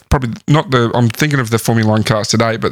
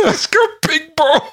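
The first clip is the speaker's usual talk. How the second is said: laughing; slower than usual. Real, not acted. Repeating punchline while laughing.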